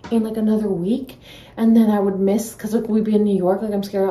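ordinary talk

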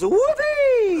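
high delighted whoop; excited cheer. Real, not acted. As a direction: cheer with joy. Children cheer at the recipe.

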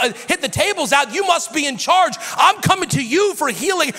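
high, loud, eager exclaiming; thrilled crowd crying out to Jesus; excited praise. Retold or imitated. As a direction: cry out amazed to Jesus.

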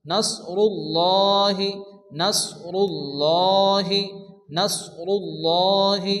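নাসরুল্লাহি with a full, heavy lam, slowly; heavy lam in Allah. Shown as a demonstration. Teacher drills students on the heavy lam in নাসরুল্লাহি. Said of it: "লামকে পুর অর্থাৎ ল উচ্চারণ করে পড়তে হয়".

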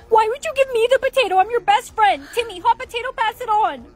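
high, loud panicked yelling; frantic shouting. Acted.

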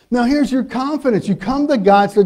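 normal talking voice

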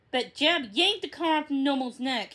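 ordinary delivery, nothing marked

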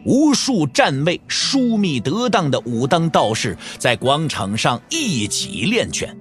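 usual speaking voice

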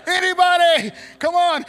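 very high, loud comic yell; mock cry for help. Stranded in the boat, calling to the crowd in a high mock yell. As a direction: yelp for help like you're stranded. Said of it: "I'm sitting here like".

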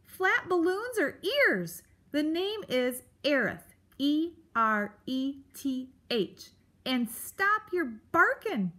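cantankerous creature, high and loud; Aerith. Acted. Grump berates the mouse about barking, testy. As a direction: scold crossly, slowing to spell out the name.

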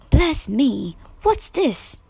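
put-on high, startled old aunt's exclamation; Aunt March catching the couple, startled cry. A narrator's reading. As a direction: exclaim as if caught off guard. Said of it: "she cried".

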